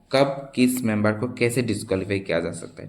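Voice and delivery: usual speaking voice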